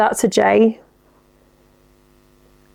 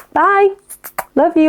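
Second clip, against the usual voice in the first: high, bright-pitched mock goodbye; sing-song goodbye; 8 semitones higher than usual. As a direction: chirp the goodbye up high, playfully. Voicing the call goodbye.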